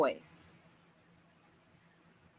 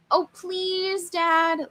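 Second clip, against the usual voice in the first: put-on much higher begging child's voice; pleading kid brother; 10 semitones higher, 3 dB louder, much slower than usual. Younger brother begging Dad to go into the park, high pleading whine. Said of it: "Luke cried".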